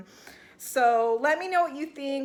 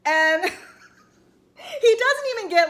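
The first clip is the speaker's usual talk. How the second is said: high and loud through a real laugh; cracking up while mocking him; 9 semitones higher, 6 dB louder than usual. Real, not acted. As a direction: burst out laughing mid-sentence. Laughing at the fake tears.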